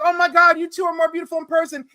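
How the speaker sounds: high, fast gushing fan. Retold or imitated. Fan gushing to a couple at a convention, high and fast. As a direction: gush over the couple. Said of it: "I'm like".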